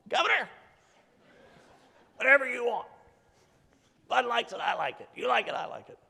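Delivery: Senator Eltife impression. Speaker mimics senator agreeing to the budget, eager high voice. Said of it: "He kinda talks like this".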